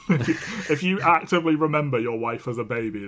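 words broken up by real laughter; laughing. Real, not acted. Host joking that the romance is creepy, laughing. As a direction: laugh through the line at the creepiness.

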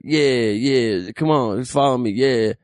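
lightly imitated dazed, noncommittal voice; a hint of out-of-it agreement. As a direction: brush the fan along, lightly in character. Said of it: "like, drugged out".

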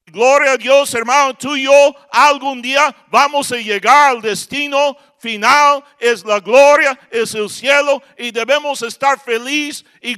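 triumphant preacher's shout; joyful preaching. Acted. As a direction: proclaim it with full force. Joyful preaching about heaven.

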